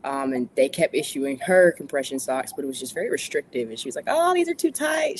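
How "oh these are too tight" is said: high, slow German granny voice; imitating her German grandma, pitched way up; mock German accent, high and slow; German grandmother accent. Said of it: "She's German, so the accent's heavy".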